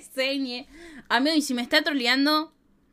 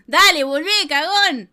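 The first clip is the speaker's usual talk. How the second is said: louder, mocking taunt; 2 semitones higher, 11 dB louder than usual. Real, not acted. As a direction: call them a coward loudly. Taunting the fleeing player.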